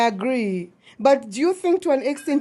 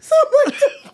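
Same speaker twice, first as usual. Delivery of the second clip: squealing laugh-cry; 5 dB louder, faster than usual. Real, not acted.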